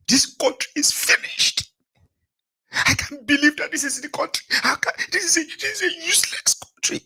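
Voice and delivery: high, breathy half-whispered lament, well above his own; mock despairing complainer. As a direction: sigh out the country's doom in a high mock voice. Mimicking another complaining Nigerian.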